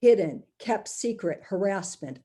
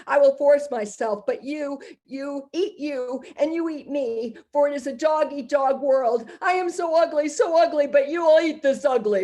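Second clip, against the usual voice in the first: [name]; 5 semitones higher than usual. Acted. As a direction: voice the abuser's ugly menace.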